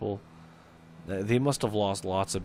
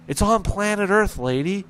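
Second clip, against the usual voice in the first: higher and louder, a sarcastic snap; exasperated retort; 10 semitones higher, 8 dB louder than usual. Retold or imitated. Caller snaps sarcastically at the clueless dispatcher, higher and louder. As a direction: fire it back in exasperation.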